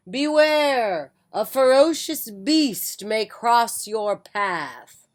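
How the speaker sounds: loud, slow, ominous warning; wizard's warning call. Acted. Wizard calling a warning to the mother, loud and slow. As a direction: boom out the warning from the bushes. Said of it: "He called out".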